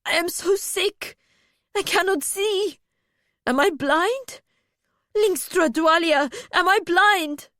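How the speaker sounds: high, frightened wail; panicked distress. A narrator's reading. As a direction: build to a loud, desperate question. Sick, trapped, fearing blindness.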